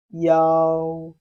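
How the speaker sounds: loud, drawn-out yao for students to copy; Chinese yao. Shown as a demonstration. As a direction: model yao clearly. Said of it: "Repeat after me".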